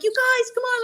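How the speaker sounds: high call through a door; high-pitched mock yell up the stairs; retold yell. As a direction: call up to the friends upstairs. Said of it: "I'm yelling through the door".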